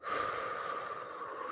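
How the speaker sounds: long shaky exhale; heavy sigh. Real, not acted. Collecting herself after tears, a heavy sigh. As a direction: let out a heavy breath.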